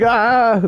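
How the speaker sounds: mock audience gasp. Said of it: "Just like".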